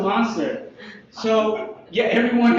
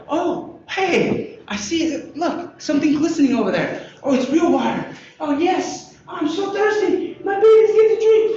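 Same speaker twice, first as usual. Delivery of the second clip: much higher, building to a shout; rising joy at spotting water; excited discovery; 10 semitones higher than usual. Acted. Thirsty traveler spots water, building to an excited shout. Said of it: "you're like".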